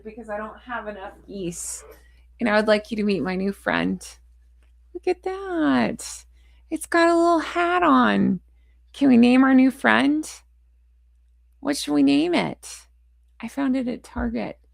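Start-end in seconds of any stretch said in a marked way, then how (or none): 4.9-12.9 high, cutesy showing-off voice; cooing at a toy. Real, not acted. Showing off a new toy goose.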